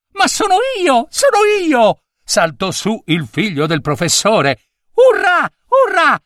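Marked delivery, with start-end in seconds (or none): 0.1-2.0 much higher, thrilled "sono io"; the boy can't contain his excitement; excited cry. Acted. Boy learns he is Gino. 4.9-6.2 much higher, louder hurrah; a boy cheering wildly. Acted. Boy cheers his luck.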